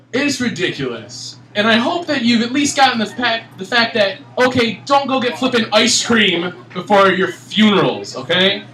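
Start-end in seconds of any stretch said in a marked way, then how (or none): none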